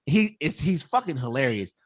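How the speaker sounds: usual speaking voice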